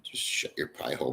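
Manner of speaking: normal talking voice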